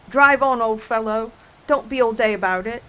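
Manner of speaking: loud impatient bark at the turtle; impatient order. Acted. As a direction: bellow at him to hurry up.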